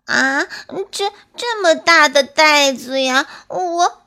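high, tearful stammer; dismayed at the huge sack; little squirrel on the edge of crying; troubled whimper. Acted. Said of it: "为难地说".

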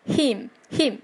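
him with a very quick short i. Shown as a demonstration. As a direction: say him short and fast, twice. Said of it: "非常的快速".

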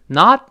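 "not" cut off with a held, unreleased t; brief stop after not. Shown as a demonstration. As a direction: say "not", stop a split second before the next word. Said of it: "稍微停那么百分之一秒".

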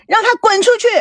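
very high, exasperated outcry; frantic angry yell. A narrator's reading. Daughter wanting the visitor gone, frantic angry yell. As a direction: demand it in a flustered rage. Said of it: "气急败坏地喊着".

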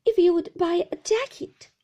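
timid, high-pitched plea; frightened young customer speaking up; trembling. A narrator's reading. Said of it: "I said, trembling".

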